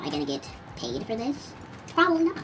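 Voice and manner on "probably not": sarcastic. Real, not acted. Gamer dog asking if he gets paid, high sarcastic answer. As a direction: answer your own question with scorn.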